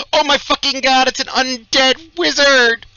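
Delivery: high, loud shriek of alarm; mock panicked scream. Game master jokingly answering how to pronounce lich, screaming. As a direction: scream it as if the monster just appeared.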